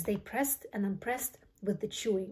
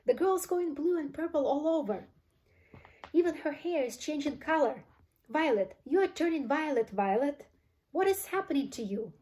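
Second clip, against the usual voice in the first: high, panicky exclamations; alarmed mother, pitched high; horrified yell; 6 semitones higher than usual. A narrator's reading. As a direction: cry out in mounting dismay. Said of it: "yelled Missus Beauregard".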